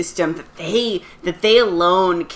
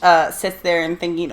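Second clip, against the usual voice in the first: normal talking voice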